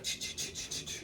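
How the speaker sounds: quick voiceless breaths, ch ch ch; mock panting breaths from the climb; imitated panting. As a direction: puff it out like climbing hard. Panting up monkey bars.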